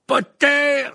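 very loud, high shout of a name; commanding, drawn-out yell; sharp call. A narrator's reading. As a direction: shout to stop someone in their tracks. Snape calls out sharply to Harry.

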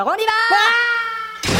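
hyped showman. Acted. Host hypes the ride.

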